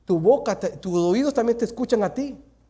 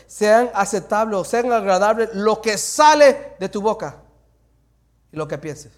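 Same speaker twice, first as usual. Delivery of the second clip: slow scripture reading; 7 dB louder, slower than usual. Acted. Preacher reads the psalm slowly aloud to the church. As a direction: read it slowly and loud, like a blessing.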